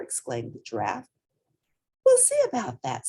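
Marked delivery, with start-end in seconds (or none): 2.0-3.1 high, stubborn little voice; defiant child; determined. A narrator's reading. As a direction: refuse to be told no. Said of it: "said Portly".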